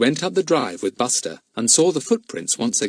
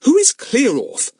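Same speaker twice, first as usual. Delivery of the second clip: high questioning mother; mother asks sharply; puzzled mother; 14 semitones higher, 5 dB louder than usual. A narrator's reading.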